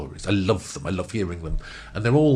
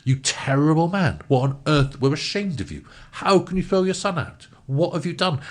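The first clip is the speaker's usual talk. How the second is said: retold scolding; 7 semitones higher than usual. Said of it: "The lads all turned around and said".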